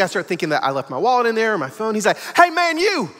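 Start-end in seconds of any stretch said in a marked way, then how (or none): 2.4-3.1 high retold call; urgent mock yell at someone; screaming. Stranger running up to Marcus, acted high yell. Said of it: "he's screaming".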